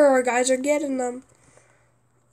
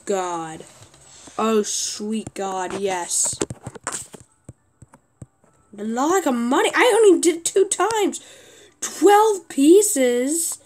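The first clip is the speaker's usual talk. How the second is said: usual speaking voice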